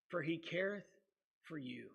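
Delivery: preacher reads the promise to the congregation, fading to a hush; hushed scripture. Acted. Let the verse fall away to almost nothing. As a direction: voice drops low and near-silent on the last words.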